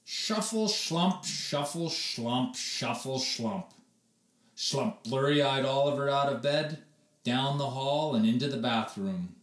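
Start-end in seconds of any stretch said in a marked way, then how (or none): none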